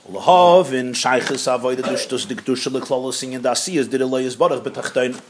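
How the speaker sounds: low monotone recitation; reading text aloud. Acted. Teacher reading the next passage to students, low monotone. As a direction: read it on one low, steady note.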